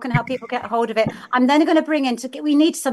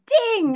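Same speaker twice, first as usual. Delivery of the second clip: imitated bell ding; 11 semitones higher than usual.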